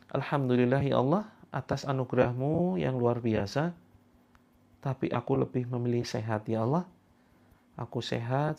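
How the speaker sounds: soft, hushed affirmation that fades; guided affirmation. Shown as a demonstration. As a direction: murmur each thanks gently, letting it sink in. Afirmasi lembut dan pelan. (afirmasi lirih yang makin pelan)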